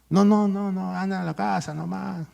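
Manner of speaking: high, impatient brush-off in a put-on voice; dismissive son. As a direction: wave the old mother away. Friend brushing off his mother.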